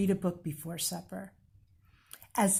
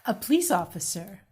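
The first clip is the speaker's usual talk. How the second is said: ordinary delivery, nothing marked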